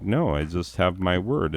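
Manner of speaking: ordinary talk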